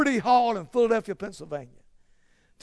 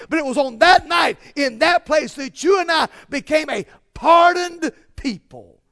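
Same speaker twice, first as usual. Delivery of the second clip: loud, higher climactic pulpit cry; full-force preaching; 4 semitones higher, 5 dB louder than usual. Real, not acted. Preacher proclaiming pardon to the congregation, impassioned climax.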